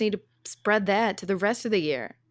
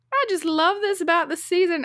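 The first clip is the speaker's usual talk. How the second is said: a cheery Southern lady's line; attempted Southern accent; 10 semitones higher than usual. Woman hugging stranger, said in mock Southern accent. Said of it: "I can't do a Southern accent".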